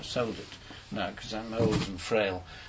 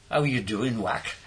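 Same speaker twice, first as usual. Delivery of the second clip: Scouse greeting; 4 dB louder than usual. Retold or imitated. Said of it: "When I say Whack".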